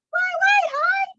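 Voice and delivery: very high squeaky call. Shown as a demonstration. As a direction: squeak it up high like a tiny bird. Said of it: "the other named High".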